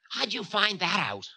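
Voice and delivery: high-pitched querulous retort; Time, retorting. A narrator's reading. Time questioning Ravana's claim about love, querulous.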